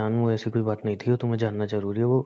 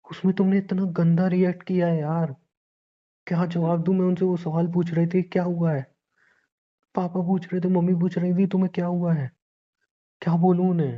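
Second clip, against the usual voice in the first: higher-pitched, worked-up complaint; exasperated reproach; 5 semitones higher than usual. Acted. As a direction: reproach your wife, at a loss for answers. Husband reproaches wife after the scene. (परेशान होकर ऊँचे सुर में शिकायत)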